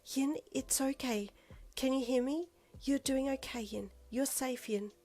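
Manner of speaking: slow, soft, high reassurance; calm her gently; reassuring. A narrator's reading. Calming a panicking friend.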